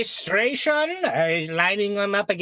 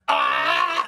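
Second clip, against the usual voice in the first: sharp low pirate shout; pirate yell. Pretend. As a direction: bark a pirate cry. Player yells pirate-style.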